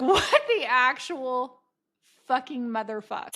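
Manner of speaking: slow, drawn-out incredulous swearing; hammed-up disbelief. Telling listeners of the screensaver shock, drawn-out disbelief. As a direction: stretch it out in comic outrage. Acts out her shocked inner reaction.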